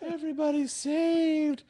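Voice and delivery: very high, drawn-out relief; hammed-up squeaky, slow rejoicing; mock revived crew. Retold crew rejoicing on waking.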